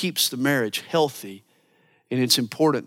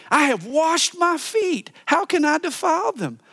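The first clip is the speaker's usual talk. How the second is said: whiny wife excuses; 12 semitones higher than usual. Retold or imitated. Preacher mimics the wife refusing her husband, high and whiny. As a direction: drag out the excuse like a pouty bride. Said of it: "She says again".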